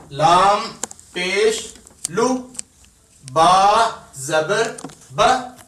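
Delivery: लु ब दन said as separate short syllables with pauses, slowly; spelled apart. Shown as a demonstration. Teacher showing how to spell a word for children, slow and spaced. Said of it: "लुबादन इकट्ठा बिल्कुल आपने नहीं पढ़ाना".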